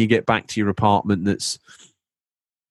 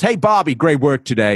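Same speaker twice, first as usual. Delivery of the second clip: much higher, loud hearty boss shout; boss calling out; 7 semitones higher, 4 dB louder than usual. Retold or imitated. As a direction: call out like a proud foreman.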